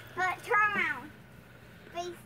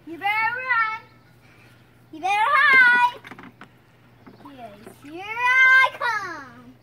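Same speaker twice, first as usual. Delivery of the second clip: sharp playground warning; 8 dB louder than usual. Pretend. Playful water chase threat.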